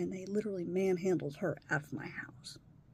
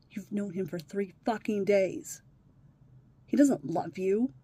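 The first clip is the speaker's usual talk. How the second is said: normal talking voice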